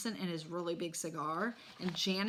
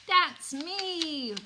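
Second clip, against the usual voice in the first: put-on toddler voice; 10 semitones higher, 7 dB louder than usual.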